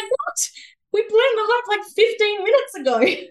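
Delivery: high, incredulous squeak of disbelief; astonished retold reaction. Friend's startled reaction, retold.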